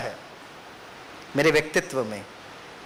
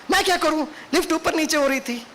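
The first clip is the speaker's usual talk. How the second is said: higher, sharp defensive excuse; angry, egoistic excuse; 8 semitones higher than usual. Retold or imitated. Lecturer replaying her irritated excuse to Baba, in anger. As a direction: snap the excuse back with ego. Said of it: "गुस्से में बोल रहे, ego में बोल रहे हैं".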